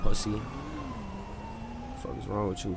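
normal talking voice

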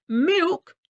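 high, loud outraged MILK; incredulous farmer's shout; roared. A narrator's reading. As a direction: roar MILK in disbelief. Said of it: "roared the farmer".

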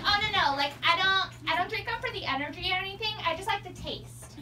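lightly voiced breezy college girl; defensive girl. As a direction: rush the excuse like a caught-out girl. Red Bull girl explains.

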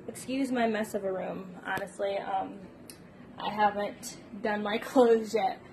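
ordinary delivery

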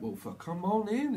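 high, bright-pitched hammed-up welcome; mock cheery welcome. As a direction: throw the door open in a mock welcome. Voicing welcoming person.

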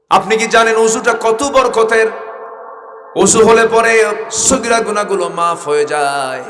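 high, loud, slow fiery sermon cadence; full-force preaching. Acted. Preacher voices God's call to his servant, loud and fervent. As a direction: thunder God's promise over the crowd.

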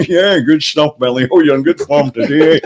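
amused film-star impression; laughing approval in an actor's voice; Sean Connery. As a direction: impersonate a famous actor chuckling along. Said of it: "he's laughing away".

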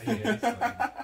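high, loud laughing reply. Real, not acted. Laughing at him sweating.

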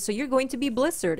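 ordinary talk

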